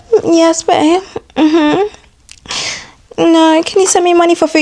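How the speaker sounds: high, cutesy sweet-talk voice; mock a girl putting on softness for men; fake soft-spoken girl. Woman sweet-talking her man for money, mock soft-spoken. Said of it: "you guys think you're soft-spoken or something".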